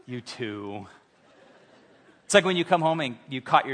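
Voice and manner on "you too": deep, much softer, dry voice of God; low God voice. Retold or imitated. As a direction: answer low and deadpan. God answering Adam.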